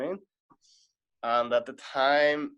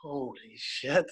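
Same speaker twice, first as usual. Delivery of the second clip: slow, drawn-out stunned reaction; retold slow-motion shock; stunned disbelief; much slower than usual. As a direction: stretch it out, stunned and sinking. Stunned reaction to the hotel news.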